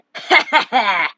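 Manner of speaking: loud scornful cackle; mocking laugh. Real, not acted. Vlogger laughing at a rival's character, loud and mocking. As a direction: laugh it off with contempt. Laughs at his own jab.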